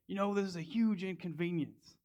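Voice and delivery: mock-annoyed complaint from the old resident; grumpy old man.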